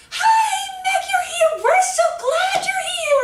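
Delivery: very high, loud, sing-song greeting; over-the-top squealing welcome, mocking; gushing director impression. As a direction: greet an adult as if she were three. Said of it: "She talked to the teachers like that".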